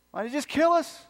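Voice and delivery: high-pitched exasperated grumble; mock complaint. Preacher voices Israelites grumbling at Moses and Aaron, complaining. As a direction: protest it like an aggrieved complainer.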